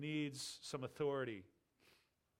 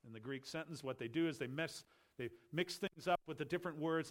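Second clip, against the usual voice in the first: normal talking voice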